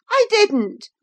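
high, loud, outraged denial; indignant cry. A narrator's reading. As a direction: burst out protesting innocence. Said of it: "she cried, recovering her speech".

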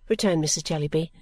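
usual speaking voice

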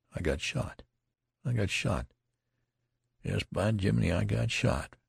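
bewildered. A narrator's reading. Wounded soldier reiterating his injury, bewildered. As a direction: puzzle over it as if dazed. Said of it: "He reiterated this fact in a bewildered way".

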